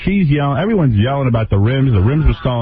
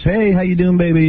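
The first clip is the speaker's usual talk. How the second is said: lightly voiced higher Whitney; a hint of a sweet greeting, pitched up; mock friendly hello; imitating Whitney; 9 semitones higher than usual.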